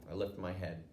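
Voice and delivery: plain reading voice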